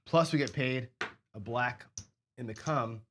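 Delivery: usual speaking voice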